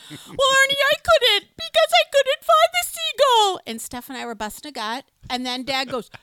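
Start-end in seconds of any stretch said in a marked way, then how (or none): 0.1-3.6 much higher, louder, tearful protest; mock sobbing mom. Mom answering dad, mock wail. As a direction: wail back at him in mock tears. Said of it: "sobbing speech".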